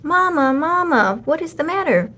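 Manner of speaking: anxious kittens. A narrator's reading. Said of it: "cried Tutu, Marie, and Burley".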